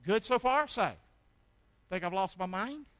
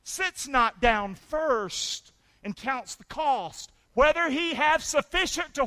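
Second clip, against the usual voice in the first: very slow and louder, word by word; slow, weighty scripture; 7 semitones higher, 9 dB louder, slower than usual. Acted. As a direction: draw out each word of the verse. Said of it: "Here's what Jesus said".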